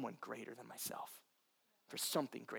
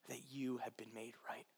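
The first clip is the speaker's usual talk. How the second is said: whisper; 3 semitones lower, 8 dB quieter than usual. Real, not acted. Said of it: "whispered affirmation".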